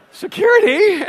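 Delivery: high, loud comic call; exaggerated yell for rescue; mock cry for help. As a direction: call out in hammed-up alarm.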